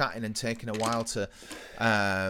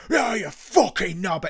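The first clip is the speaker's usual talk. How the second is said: retold angry shout; 6 semitones higher, 7 dB louder, slower than usual. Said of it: "screaming and shouting".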